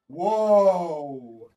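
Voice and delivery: loud awed whoa; be the stunned crowd; loud impressed crowd gasp; amazed crowd whoa. Retold or imitated. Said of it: "the others are like".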